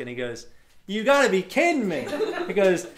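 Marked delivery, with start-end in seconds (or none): 0.9-2.1 high, loud mock disbelief; incredulous driver. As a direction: balk loudly at an absurd plan. Acts the driver's disbelief at Alaska.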